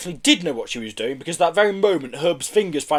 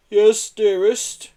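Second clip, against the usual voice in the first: slow, higher, cowed whimper; 7 semitones higher, 4 dB louder, much slower than usual. A narrator's reading. Herb answering his threatening wife, whimpering. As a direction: give in meekly. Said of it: "he whimpered".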